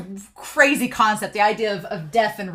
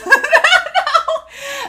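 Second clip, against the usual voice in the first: real high laugh; 7 dB louder than usual. Real, not acted. Viewer cracking up at her own Floch joke, bursting into high laughter.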